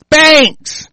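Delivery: a sudden high, loud yell of a single word; shouted outrage. Real, not acted. Ranting about foreign banks here, yelling in outrage.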